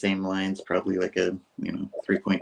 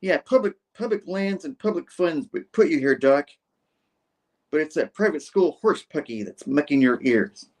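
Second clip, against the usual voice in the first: higher, emphatic comeback; mock defiant retort; 6 semitones higher than usual. Cameraman arguing with the doctor over public lands, acted defiance. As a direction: argue back in a lightly acted cameraman voice.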